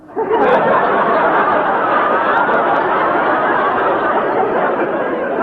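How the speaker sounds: breathy laugh. Acted. Miss Brooks laughs at compliment.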